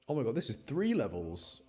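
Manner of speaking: normal talking voice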